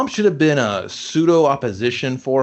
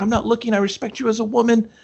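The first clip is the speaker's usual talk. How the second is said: higher, earnest nerdy voice; mock gallant boy; 10 semitones higher, faster than usual. The boy grabbing her towel, higher earnest mock gallantry. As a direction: play the awkward gentleman. Voicing the boy covering his eyes.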